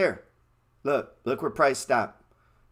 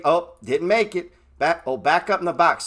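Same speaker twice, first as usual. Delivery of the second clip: ordinary delivery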